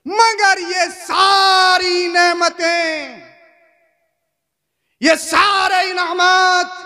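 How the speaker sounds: loud, high, slow crying shout; tearful cry. Acted. Preacher to congregation about worldly blessings, crying out loud and slow. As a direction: let the voice break into a wail.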